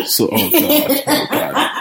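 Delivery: real laughter, high; laughing. Real, not acted. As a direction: laugh through "oh God". Cracking up at the song.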